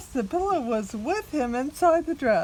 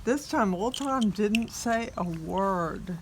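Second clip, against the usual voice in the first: ordinary delivery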